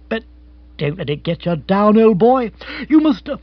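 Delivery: emphatic, hearty commiseration; pompously sympathetic brother-in-law. Acted. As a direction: console with ponderous sympathy, then rally him. Rory begins his pep talk.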